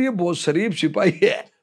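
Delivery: ordinary delivery, nothing marked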